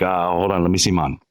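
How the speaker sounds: low-pitched imitation of a Jamaican accent; mock Jamaican local, low and casual; Jamaican man. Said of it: "I go up to the guy".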